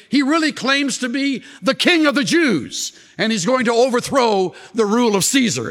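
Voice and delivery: high-pitched, urgent accusation; acted accusers. Accusers bringing a treason charge to the Roman court, acted insistent accusation. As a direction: press the charge emphatically before a court.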